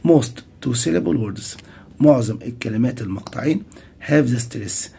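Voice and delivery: ordinary talk, nothing marked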